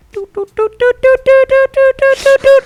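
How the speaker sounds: high beeping detector noise; imitated beeping. Imitating the EMF reader in the game, high beeps. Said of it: "the E M F reader".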